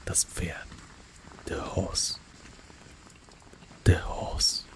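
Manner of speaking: ordinary talk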